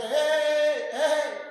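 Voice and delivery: high, loud call imitating a shepherd; mock shepherd's call to the flock; sheep-calling shout, fairly loud; stranger calling sheep. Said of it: "empezó a gritarle como el pastor".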